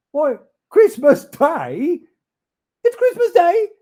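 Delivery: very high, loud exclamation; amazed joy. Acted. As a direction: gasp with delight. Scrooge amazed.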